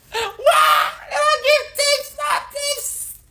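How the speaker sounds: very high falsetto outcry; hammed-up high shriek; mock wail. Mock outcry over Africans not tipping.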